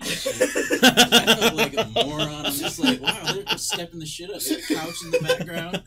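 laughing hard, high and loud. Real, not acted. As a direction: crack up through the words. Laughing at own embarrassing mistake.

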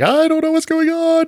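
very high panicked squeal; comic high-pitched bewilderment; mock panic. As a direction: play a clueless, overwhelmed newbie, pitch way up. Imagines promoted angels overwhelmed.

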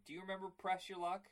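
ordinary talk, nothing marked

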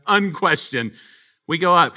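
ordinary talk, nothing marked

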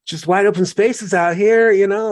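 high, lifted sales pitch for the West; a mock eager pitch to a friend back east; excited quote. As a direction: talk up the open spaces with eager delight. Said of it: "I'm like".

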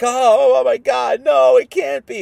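high, loud hammed-up distraught cry; mock wailing. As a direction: wail it like mourners in disbelief. Weeping crowd at the cross.

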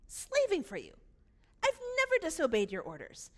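rising resentment; angry complaint. Acted. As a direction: hit the grievance hard and loud. Repeats the complaint, escalating.

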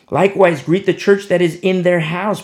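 loud, lifted reading of the verse; booming pulpit reading; scripture reading. Acted. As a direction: proclaim the verse to the room. Reading scripture aloud to congregation.

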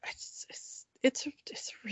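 hushed whisper. Real, not acted. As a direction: breathe it out in a whisper. Suddenly whispers praise.

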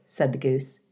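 usual speaking voice

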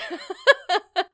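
high, loud burst of happy laughter; delighted laugh. Real, not acted. Laughing to listeners about the call.

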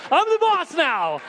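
very high, slow, drawn-out declaration; retold line turned into a soaring proclamation; triumphant preaching cry. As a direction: cry it out like a preacher at full pitch. Preacher repeats it at full force.

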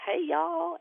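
high-pitched imitation Southern greeting; hey-y'all Southern accent. Mom quoting the doctor her daughter doubted, high Southern accent.

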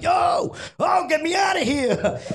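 high shout after a departing bus; calling out. Retold or imitated. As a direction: holler to stop the driver.

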